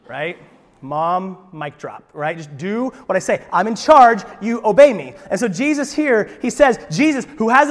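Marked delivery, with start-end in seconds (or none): 2.4-5.1 loud, commanding mock mom; a parent barking the final word; bossy mom. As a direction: lay down the law like a mom. Mom voiced asserting authority.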